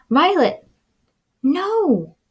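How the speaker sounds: high scolding call to the dog; high, loud scolding cry. Acted. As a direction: shout no at the dog from off the page.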